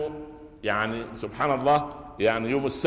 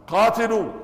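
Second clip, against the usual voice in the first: battle cry; 10 semitones higher, 7 dB louder, slower than usual. Acted. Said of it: "فتقول رضي الله عنها".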